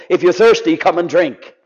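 ordinary talk

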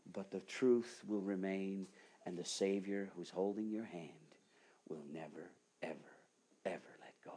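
tender whispered promise; hushed whisper. Acted. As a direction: drop to an intimate whisper of assurance. Preacher's hushed promise of comfort.

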